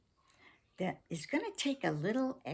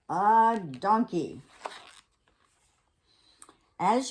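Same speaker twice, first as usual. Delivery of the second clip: fast-talking salesman. Acted. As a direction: sell it like a TV ad. Fox sales pitch.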